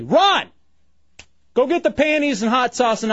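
sudden loud command; shout. Real, not acted. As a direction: bark it as an order. Ordering someone to fetch items.

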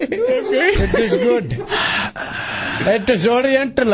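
slow, drawn-out mock Indian accent; Indian accent impression. Co-host keeps teasing in mock Indian accent. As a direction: lean into the put-on Indian accent. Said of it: "your accent".

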